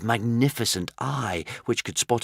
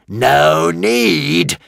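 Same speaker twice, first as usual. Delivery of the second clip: louder, higher dragon roar; furious roar; 7 semitones higher, 8 dB louder than usual. A narrator's reading. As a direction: hurl the words back in rage. Raging dragon repeats words.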